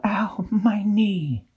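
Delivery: high, slow little whimper; fairy in pain. A narrator's reading. Hurt fairy thinking about her knee. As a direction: moan it out small and slow. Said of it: "She thought".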